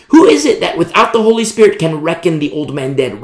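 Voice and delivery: ordinary delivery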